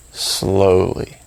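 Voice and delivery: drawn-out word, set apart by a pause; slowly. Shown as a demonstration. Meditation guide showing listeners how to say a phrase, slowly. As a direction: stretch the word to model slowness. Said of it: "I want you to say it".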